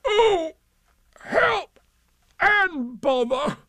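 high, drawn-out cry for help; despairing wail. A narrator's reading. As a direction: wail in stuck frustration. Pooh stuck, wailing for help.

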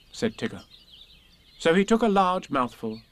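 plain reading voice

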